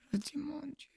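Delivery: breathless whispered prayer; frightened whisper. Retold or imitated. Survivor recalling her prayer as soldiers came, terrified whisper. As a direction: whisper it in terror.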